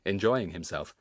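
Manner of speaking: ordinary delivery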